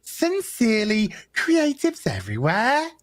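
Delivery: high, slow ditzy airhead read; bimbo voice. Acted. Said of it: "we gotta say it in bimbo voice".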